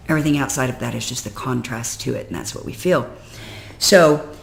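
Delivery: normal talking voice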